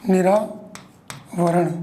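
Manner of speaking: very slow, word broken for note-takers; dictation. Real, not acted. Teacher to students writing down word meanings, slow dictation. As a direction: split in two by a long pause.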